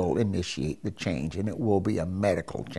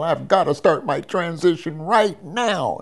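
higher, louder urgent blurt; 7 semitones higher, 5 dB louder than usual. Retold or imitated. Patient suddenly confessing to the doctor, blurted out. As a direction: burst out with it to the doctor. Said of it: "I blurted it out right then and there".

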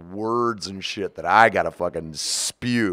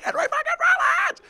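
a real laughing shout, much higher; starstruck shout; 4 dB louder than usual. Real, not acted. Fan laughing about meeting [name], excited shout. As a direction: blurt it out, giddy and amazed.

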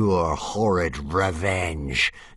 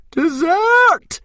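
piercing, much higher, louder dragon shriek; 9 dB louder than usual. Acted. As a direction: screech it out like a greedy little dragon. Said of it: "she shrieked".

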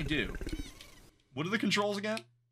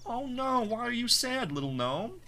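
higher, sing-song pity voice for a little creature; mock sympathy for a tiny gnome; cooing at the gnome; 9 semitones higher than usual. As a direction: coo at it like a sad pet. Consoling a sad little gnome.

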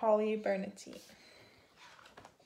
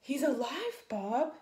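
higher, lightly acted cry of relief; exclaiming boy; 4 semitones higher, slower than usual. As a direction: call out the good news, lightly acted. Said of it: "cried Mark".

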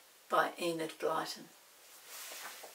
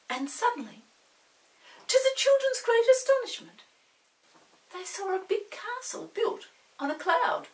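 much higher and louder, full of wonder; astonished narration; 11 dB louder than usual. A narrator's reading. Reading the castle on a cloud with wonder, high and loud. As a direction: build up to the big reveal.

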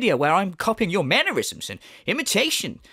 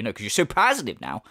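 ordinary talk

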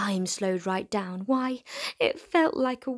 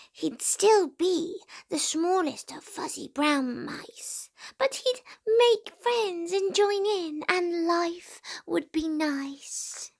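a bit higher hopeful little mouse; a small mouse daydreaming; lightly voiced hopeful mouse; 7 semitones higher than usual. A narrator's reading.

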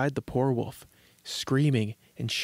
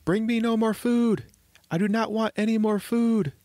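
pleading shout, pitched way up; terrified calling out; 10 semitones higher, 4 dB louder than usual. A narrator's reading. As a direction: call out in a high, panicky little voice. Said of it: "began to call out in terror as loudly as he could".

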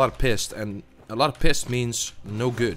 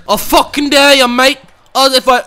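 much higher and louder mock Aussie dare; loud mock Aussie taunt; 12 semitones higher, 12 dB louder than usual. Gamer daring his opponent in a hammed-up Aussie accent.

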